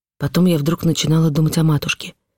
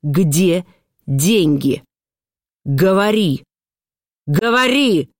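stern interrogation building in pitch; angry demand; 2 semitones higher, much slower than usual. A narrator's reading. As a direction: press harder with each drawn-out word. Mother interrogating the child, escalating.

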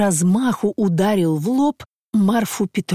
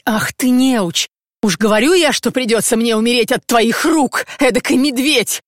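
higher, louder tearful tirade; furious scolding; 5 semitones higher, 4 dB louder than usual. Acted. Injured wife berates her clumsy husband, loud tearful scolding. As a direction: scold him in a hurt, sobbing rage. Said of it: "кричала она, всхлипывая и задыхаясь".

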